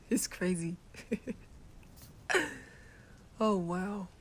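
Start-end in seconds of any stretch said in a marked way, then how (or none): 0.8-3.1 higher-pitched giggle; chuckle. Acted. Amused at his own voice crack, chuckling. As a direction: break into short chuckles.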